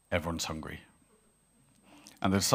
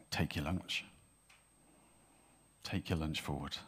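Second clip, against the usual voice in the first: very soft, lower prompting; inner voice; 4 semitones lower, 10 dB quieter than usual. Acted. Inner voice prompting the young lad, very soft. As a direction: urge it quietly, as a thought from within. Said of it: "this inner voice rose up inside".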